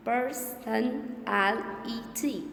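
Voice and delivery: "Person, L E T" as five separate beats; teacher breaking the word "Person, L E T" apart; split into syllables. Shown as a demonstration. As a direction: sound out per, son, al, i, ty. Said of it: "Five syllables".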